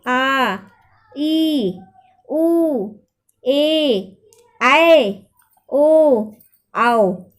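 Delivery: each long vowel said alone, held and clear; long vowels drawn out. Shown as a demonstration. Teacher to children listing long vowels, drawn out and clear. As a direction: call out the long vowels one by one, stretching each. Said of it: "तो सात में देखिए कौन है?".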